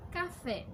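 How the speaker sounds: "café" with the stress on final FÉ; stress on last syllable. Shown as a demonstration. Teacher giving children an example of oxítonas, final-syllable stress on "café". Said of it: "A última sílaba mais forte é o fé".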